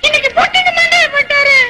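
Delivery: high put-on wail; hammed-up tearful cry. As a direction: insist through fake tears. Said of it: "ஏமா அழுகுற?".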